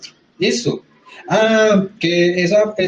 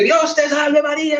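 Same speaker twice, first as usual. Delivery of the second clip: high-pitched mock yell of a prayer; a hammed-up cry of Dios te salve María; mock street shouting; 10 semitones higher than usual. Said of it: "vamos gritando por la calle".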